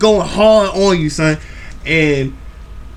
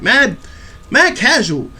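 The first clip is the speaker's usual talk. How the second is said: ordinary talk, nothing marked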